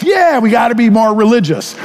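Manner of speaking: hammed-up dutiful yes; mock eager congregation. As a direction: chime in like a keen congregant. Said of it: "you all would respond with".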